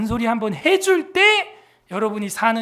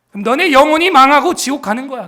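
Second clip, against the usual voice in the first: louder, higher stern warning; 4 semitones higher, 12 dB louder than usual. Real, not acted. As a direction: preach it loud like a warning. Warning children in sermon.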